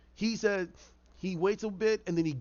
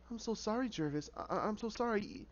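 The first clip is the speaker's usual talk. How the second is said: softer, gentle sympathy; soft consoling; 5 semitones higher, 8 dB quieter than usual. Retold or imitated. Alice consoling a devastated Jervis, soft and gentle. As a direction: comfort him quietly.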